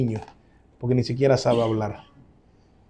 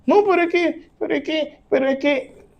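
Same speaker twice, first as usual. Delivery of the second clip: much higher put-on whine of stammered excuses; whiny excuse; 13 semitones higher than usual. Pastor mocking a congregant's excuses in a high whine. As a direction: mimic someone dodging with feeble buts. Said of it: "believer keeps making excuses".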